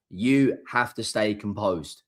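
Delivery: ordinary talk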